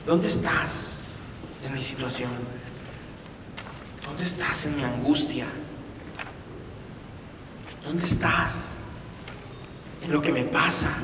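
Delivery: soft, half-whispered plea; hushed anguish. Acted. A troubled believer whispering to God in anguish. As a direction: barely voice the despair. Anguished prayer voiced softly.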